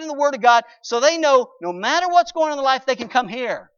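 thundering pulpit crescendo; preaching at full force. Acted. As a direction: build to a fervent peak. Sermon climax.

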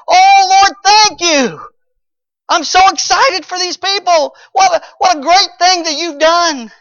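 high, loud, overjoyed exclaiming; excited praise. Acted. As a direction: burst with joy for these people. Jonah voiced praising God.

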